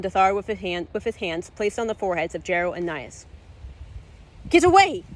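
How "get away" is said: much higher, louder scream; mother screaming at the villain. Acted. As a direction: scream it to save the twins. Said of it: "Elise screamed".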